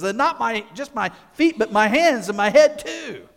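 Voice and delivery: high, loud, tumbling eagerness; eager overreaction. Retold or imitated. Peter overreacting to Jesus, high eager rush. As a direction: blurt it out too eagerly. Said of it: "Peter overreacts and says".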